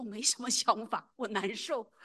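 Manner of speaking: loud retold outburst of distress; upset retort. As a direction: raise the voice, retelling an upset reply. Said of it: "就觉得难受". (复述时提高音量诉说难受)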